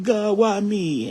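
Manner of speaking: the weeping mourner she refuses to be, hammed up; mock lament. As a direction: parody self-pity, stretched out. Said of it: "weep and cry and be like".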